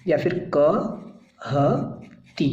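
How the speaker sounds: कहती stretched very slowly, each syllable clear; drawn-out demo. Shown as a demonstration. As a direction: draw out या फिर कहती slowly. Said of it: "अब इसका मतलब है".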